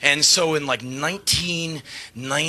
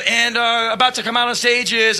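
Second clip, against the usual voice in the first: louder, higher stage build-up; mock announcer; 8 semitones higher, 4 dB louder than usual. Singer building up the guest's entrance, louder MC. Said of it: "I go".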